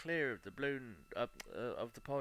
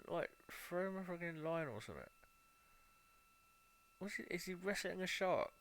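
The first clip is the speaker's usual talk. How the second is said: alarmed calling out; 6 semitones higher than usual. Real, not acted. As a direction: yell at the stranded diver to come back. Calling out to a lost diver.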